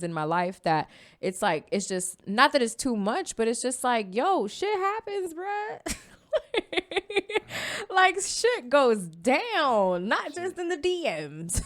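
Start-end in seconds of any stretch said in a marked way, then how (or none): 4.1-6.0 high, drawn-out, breaking into laughter; laughing exclamation. Real, not acted. Host owning up to a messy life, high laughing exclamation. 7.9-11.6 high, stretched-out comic delivery; hammed-up exclamation. Real, not acted. Host joking about drama in real life, high drawn-out exclamation.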